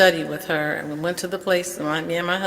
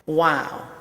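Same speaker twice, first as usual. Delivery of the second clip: usual speaking voice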